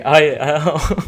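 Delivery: normal talking voice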